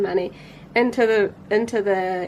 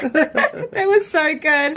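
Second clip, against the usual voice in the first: slow words through laughter; laughing; 6 semitones higher, 4 dB louder, much slower than usual. Real, not acted. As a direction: say it while still laughing. Laughing at the memory.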